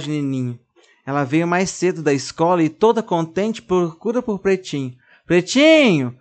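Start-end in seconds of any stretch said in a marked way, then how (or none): 5.3-6.2 calling out. Acted. Girl calling for lost doll.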